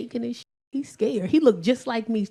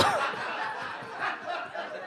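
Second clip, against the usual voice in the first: wheezing laugh; 11 semitones higher, 11 dB quieter than usual. Real, not acted.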